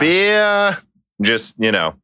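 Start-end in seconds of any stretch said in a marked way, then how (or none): 0.0-0.8 hammed-up Boston accent, pitched way up; mock Boston yell. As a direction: shout "Beer?" like a Bostonian with no r. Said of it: "and in Boston accent".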